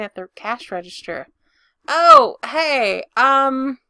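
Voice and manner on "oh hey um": startled. Acted. Pete surprised to see Gabe.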